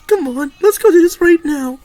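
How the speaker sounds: ordinary talk, nothing marked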